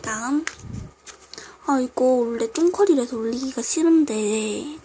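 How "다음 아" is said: ordinary delivery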